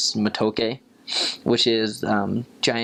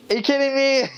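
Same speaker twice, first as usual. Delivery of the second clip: much higher, incredulous "are you kidding me"; exasperated disbelief; 4 dB louder than usual. Retold or imitated. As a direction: throw up your hands in mock disbelief. Missionary's exasperation at the no-show.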